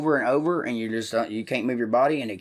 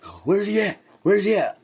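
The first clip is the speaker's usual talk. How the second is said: high, loud, itching for a fight; pumped-up tough guy hunting his target; riled up; 5 semitones higher, 5 dB louder than usual. Retold or imitated. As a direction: call out for him, hyped and ready to brawl. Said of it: "some other motherfucker would be wild up, riled up".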